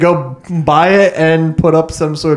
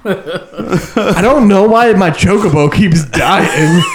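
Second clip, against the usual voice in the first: laughing; 5 semitones higher, 3 dB louder, slower than usual. Pretend. Playing clueless chocobo owner.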